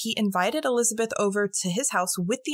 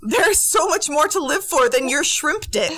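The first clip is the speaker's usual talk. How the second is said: exasperated outburst; 7 semitones higher, 5 dB louder than usual. Real, not acted. Exasperated jab at such men.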